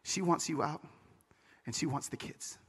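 low, hushed bad news from a friend; quiet, pitying delivery, dropping away at the end. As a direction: break it softly and low. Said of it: "with a pitied look on his face".

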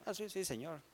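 soft, hurried mock protest of love; a touch of flustered Peter, quiet and quick. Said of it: "Pedro era así medio alteradillo".

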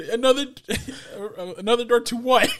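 high, laughing no-way refusal; mock refusal. Dave refusing Aaron's door, high and laughing. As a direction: refuse it while cracking up. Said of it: "He's like".

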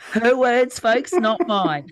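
loud, slow pointed aside; emphatic disclaimer. Real, not acted. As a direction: spell it out to the audience, wry. Disclaiming the quote to listeners.

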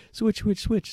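high, quick urgent command; urgent hiss. Retold or imitated. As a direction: hurry them to swap seats. Urgent order to swap seats.

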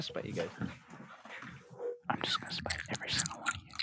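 rapid, spiteful mock whisper; ASMR whisper. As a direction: whisper fast to annoy your friends.

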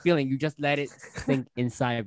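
ordinary talk, nothing marked

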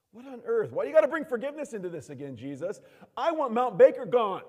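high, loud, indignant complaint; mock exasperated protest. Believer complaining to Jesus about forgiveness, mock exasperation. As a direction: protest like a thwarted believer, hammed up.

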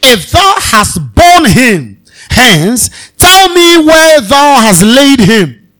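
slow, loud pleading to the gardener; pleading Mary. Acted. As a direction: beg for the master's body, drawn out.

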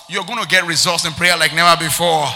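loud, full-force promise to the congregation; emphatic preaching. Real, not acted. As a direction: proclaim it boldly over the crowd.

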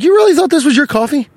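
high-pitched disbelief; incredulous challenge. Retold or imitated. Customer challenging man who claimed his coffee, incredulous. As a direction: question him as if it's absurd. Acted confrontation over coffee order.

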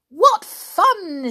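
high, loud cheer; naughty fairies cheering, loud and high; gleeful shout. Acted. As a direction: shout it with mischievous delight. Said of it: "shouted the naughty little fairies".

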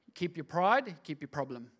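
normal talking voice

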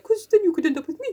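high comic suitor voice; Mister Bear. Acted. Bear wooing Jo, in a put-on voice. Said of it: "He's like".

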